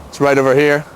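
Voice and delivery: normal talking voice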